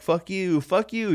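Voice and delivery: high taunting jester voices; mocking jesters. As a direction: jeer it up high. Jesters flipping him off.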